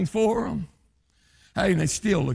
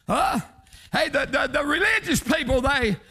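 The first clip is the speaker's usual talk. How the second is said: preaching at full force; 11 semitones higher than usual. Acted. Preacher shouting to his congregation about sinners, high whooping preaching. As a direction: ring it out over the congregation.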